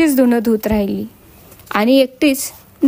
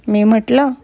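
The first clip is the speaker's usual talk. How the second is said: usual speaking voice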